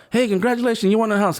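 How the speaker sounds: acted-out cheery caller; imitated prize caller. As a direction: announce a win like an upbeat caller. Voices caller announcing a prize.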